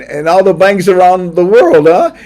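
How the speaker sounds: loud, high burst; excited outburst. Real, not acted. Host exclaiming about world banks, loud. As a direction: fire it out excitedly.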